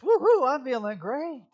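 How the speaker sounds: high, loud fake whoop; mock cheer. Pastor mocking forced cheer from a cancer patient, loud high whoop. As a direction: overdo the happy cheer.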